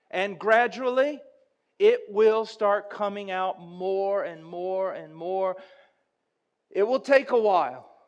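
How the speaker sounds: loud, high preacher's build-up; impassioned sermon storytelling; full-force preaching. Acted. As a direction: drive the story home from the pulpit.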